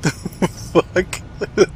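startled laughing disbelief; high, breathy what-the; surprised. Real, not acted. Sees arm in filing cabinet.

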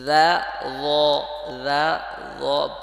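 loud, held দা then heavy rounded ল; slow pronunciation drill. Shown as a demonstration. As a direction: separate দা and ল deliberately for learners. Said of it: "আস্তে আস্তে পড়তে হবে".

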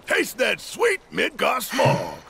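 high, slow, drawn-out shout of relief; exultant shout. Acted. Stepping outside after escape, shouting with delight. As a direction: throw your arms wide and savour it. Bursting out into open air.

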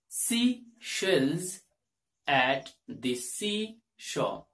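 "seashells at the seashore" stretched slowly, sh held; slow careful twister. Shown as a demonstration. Teacher slowing the tongue twister for learners. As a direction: pronounce it slowly, part by part. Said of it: "So you can pronounce this".

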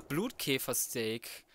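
ordinary talk, nothing marked

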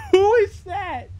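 nervous laugh, high, slow question; frightened, laughing it off; scared horror game streamer. Real, not acted. Stranger appears in game.

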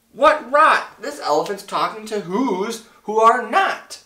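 high, loud scornful shout. A narrator's reading. Monkey brothers mock the elephant, shouting. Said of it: "came shouting".

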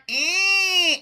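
high, whining "eh"; whiny twang noise. Shown as a demonstration. Vocal coach illustrates resonance for singers, whiny twang. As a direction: let out a held twangy whine. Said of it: "being whiny".

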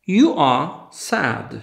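slow, carefully spaced words for a class; slow model sentence. Shown as a demonstration. Teacher to Polish students, slow clear model sentence. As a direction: say it slowly so learners can copy. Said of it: "To powtórzmy jeszcze raz".

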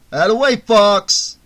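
big, boisterous shout; proud rumble. A narrator's reading. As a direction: boom it out proudly to your pal. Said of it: "Ali rumbled proudly".

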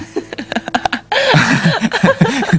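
embarrassed high giggle; high nervous laugh. Acted. Man laughs nervously at himself.

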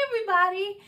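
plain reading voice

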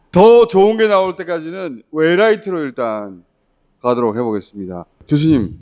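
normal talking voice

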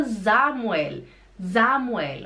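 "Samuel" opening on a buzzing Z; German pronunciation. Shown as a demonstration. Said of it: "se você quer evitar essa situação".

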